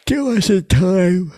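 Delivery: loud, long sigh into high, drawn-out words; tired outburst. Real, not acted. Comic apologizing for being tired, loud weary outburst. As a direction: let tiredness burst out, high and slow. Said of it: "Sorry, I'm tired".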